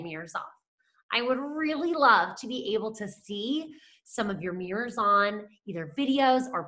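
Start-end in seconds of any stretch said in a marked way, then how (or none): 0.0-0.5 soft signal. Shown as a demonstration. Teacher showing the stop signal, soft and quiet. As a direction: drop it to a hush. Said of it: "until I say".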